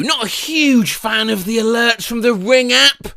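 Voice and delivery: normal talking voice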